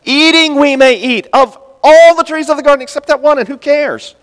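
high, loud, delighted answer; enthusiastic. Shown as a demonstration. Preacher demonstrating Eve's reply, high and enthusiastic. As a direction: show how she should have said it. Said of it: "Why isn't she talking like that?".

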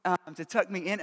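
ordinary talk

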